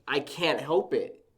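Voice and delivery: loud, drawn-out retold protest; flipping out. Nephew protesting to his uncle, retold flipping out. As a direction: push back loudly, stretching the words. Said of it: "I start flipping out on him".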